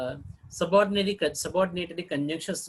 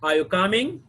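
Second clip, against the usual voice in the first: "Are you coming" loud, rising on "coming"; yes-no question rise; 2 semitones higher, 5 dB louder than usual. Shown as a demonstration. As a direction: ask it, lifting "coming" at the end. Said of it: "Expected answer should be yes or no".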